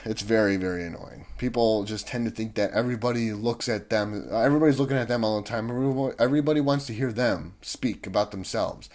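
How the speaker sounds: usual speaking voice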